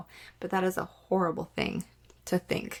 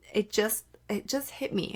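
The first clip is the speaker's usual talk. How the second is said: ordinary talk, nothing marked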